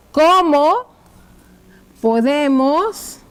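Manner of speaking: very slow, long pauses between words; writing-out dictation. Shown as a demonstration. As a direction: stretch each word while writing it. Dictates while writing the question out. (dictado muy lento, con largas pausas)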